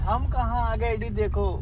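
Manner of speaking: coaxing the dog. Real, not acted.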